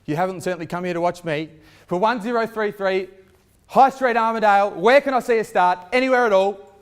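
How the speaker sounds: rally the bidders, full voice; auctioneer. Acted. Calling for bids at auction.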